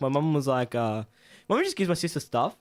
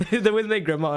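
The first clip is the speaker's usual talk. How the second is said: higher, laughing reassurance; laughing reply; 5 semitones higher, 4 dB louder than usual. Retold or imitated. Grandson explaining friends to grandma, laughing. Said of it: "She'd be like".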